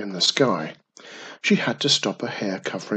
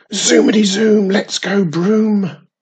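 shouting witch; 9 semitones higher, 9 dB louder than usual. A narrator's reading. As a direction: belt it out as you take off. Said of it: "shouted Wizardy Witch".

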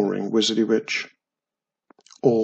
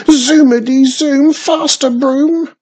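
high, much louder shouted chant; 14 semitones higher, 10 dB louder than usual. A narrator's reading. Witch shouting to her broom to go faster. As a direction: yell it at the broom. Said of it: "she shouted".

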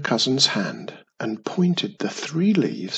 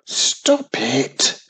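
screamed. Acted. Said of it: "she screamed".

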